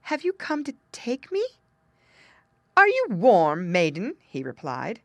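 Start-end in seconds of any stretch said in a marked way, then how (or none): 0.0-1.4 high, timid questioning; gentle trembling. A narrator's reading. Girl asking King Frost her fate, gentle and trembling. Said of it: "in a gentle, trembling voice". 2.8-4.2 loud, imperious question; lightly voiced frosty king, pushing the volume; King Frost demanding. A narrator's reading. King Frost questioning the freezing girl, loud and demanding.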